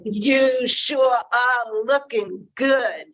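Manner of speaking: slow, sly predator voice; wolf's gloating thoughts. Acted. Wolf thinking about Red, slow sly gloating. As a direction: savour each word like a hungry wolf.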